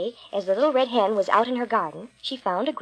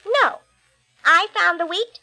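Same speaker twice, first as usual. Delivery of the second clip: high, firm, triumphant hen; firm little hen; 6 semitones higher, 4 dB louder than usual. Acted. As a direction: refuse them firmly as the hen.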